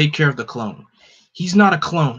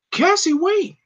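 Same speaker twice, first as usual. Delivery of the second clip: shouting after her; slower than usual. Acted. A friend shouting after Cassie as she leaves, high urgent call. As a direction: cry out for her to wait.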